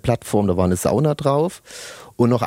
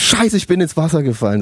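mock alarmed; 6 semitones higher, 3 dB louder than usual. Retelling falling into water.

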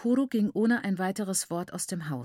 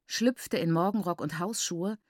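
ordinary delivery

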